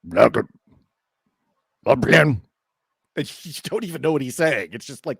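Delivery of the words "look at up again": weird guttural fishman. Acted. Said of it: "this weird guttural language".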